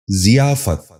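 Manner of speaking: ضیافت starting with "zi", "zi-yaa-fat"; correct pronunciation. Shown as a demonstration. Teacher giving viewers the model, correct pronunciation. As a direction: say ضیافت as ziyaafat. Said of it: "دوبارہ سنیے".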